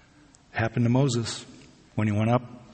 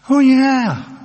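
much higher, louder cheery reply; bubbly yes; 12 semitones higher, 8 dB louder than usual. Retold or imitated. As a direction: beam it out brightly. Barista answers cheerfully.

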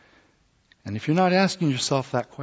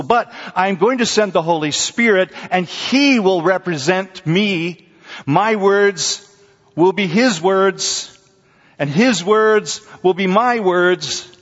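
high, loud, slow sermon build; preaching at full force; 8 semitones higher, 7 dB louder, slower than usual. Acted. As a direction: proclaim it to the congregation. Preaching Jesus' words at full force.

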